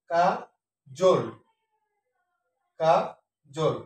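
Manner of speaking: কা and জল split by pauses, 'kaa... jol'; syllable by syllable. Shown as a demonstration. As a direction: separate each syllable of কা জল. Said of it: "সঠিক উচ্চারণ হবে". (কা... জোল, থেমে থেমে)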